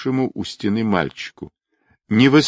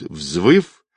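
plain reading voice

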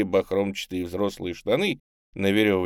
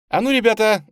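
bright-spirited, high, loud hail; rally the kids merrily; cheerful call; 14 semitones higher, 8 dB louder than usual. A narrator's reading. Tamara calling to the street kids, cheerful and loud. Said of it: "весело прозвучал голос Тамары".

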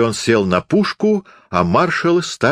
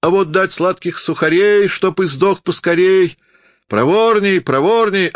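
hoarse hawker cry; 9 semitones higher than usual. A narrator's reading. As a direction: cry out to the crowd like a fairground barker. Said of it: "кричал сиплым голосом". (сиплый крик зазывалы)

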